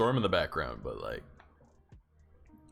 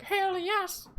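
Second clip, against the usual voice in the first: much higher, squeaky falsetto shout-out; squeaky cheer. Pretend. Streamer voicing the cute mascot cheering, high falsetto. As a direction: squeak it like a tiny cartoon mascot.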